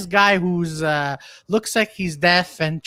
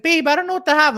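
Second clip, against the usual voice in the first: mock girlfriend; 8 semitones higher, faster than usual. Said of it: "the girlfriend's like".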